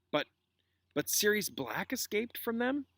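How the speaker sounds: slow, rising, incredulous question; slowly. A narrator's reading. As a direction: draw it out in disbelief. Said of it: "Harry said slowly".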